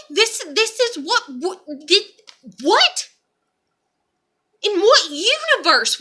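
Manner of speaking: high-pitched, stammering disbelief; can't believe what you're hearing; incredulous outrage. Real, not acted. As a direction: sputter questions in rising disbelief. Disbelief at not getting kid back.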